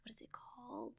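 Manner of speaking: whispered self-question; whispered aside. Real, not acted. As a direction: murmur it to yourself while trying to remember. Whispered aside while remembering.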